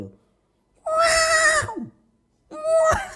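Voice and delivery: very high wordless yell; angry mock roar. Preacher imitating tired mothers at home, angry high yelling. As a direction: imitate a mother losing her temper at top pitch. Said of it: "Ibu-ibu nih kalau marah ya".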